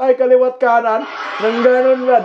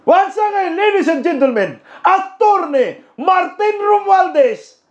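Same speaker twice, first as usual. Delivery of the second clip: higher, pleading emcee call for applause; hammed-up emcee; 7 semitones higher than usual. Emcee begging a silent crowd to applaud, pitched-up mock plea. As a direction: push the crowd to clap, overdone.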